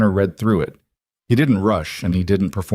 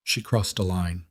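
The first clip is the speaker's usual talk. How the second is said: very quiet; 8 dB quieter than usual. A narrator's reading. Elena to Gideon over the broken bottle, very quietly. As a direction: say it very quietly, understated. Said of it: "Then she said very quietly".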